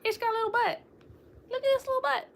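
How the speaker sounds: high, cooing voice for something tiny. Real, not acted. Vlogger coos over a tiny gummy bean figure, high baby talk.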